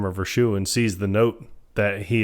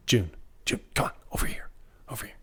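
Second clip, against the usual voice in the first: whispered beckoning, imitating the movie hero; urgent whisper; 10 dB quieter, faster than usual. Man calling June over from a wall, acted whisper. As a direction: hush it right down to call her over.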